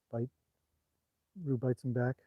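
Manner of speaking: ordinary delivery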